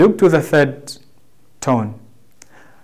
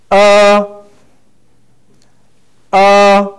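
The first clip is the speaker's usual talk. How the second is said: long, high, level "Uh"; 6 semitones higher, 6 dB louder than usual. Shown as a demonstration. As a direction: hold "Uh" loud and level, no pitch movement. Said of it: "guides us to pronounce it in long".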